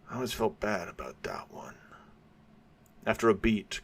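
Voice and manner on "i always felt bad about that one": a whispered, almost private admission; whispered regret. Acted. Gangster confessing the killing, whispered. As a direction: let the regret slip out in a whisper.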